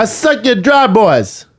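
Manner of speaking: high hammed-up Jamaican voice; mock Jamaican accent. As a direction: play a silly Jamaican dealer. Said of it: "they're Jamaican".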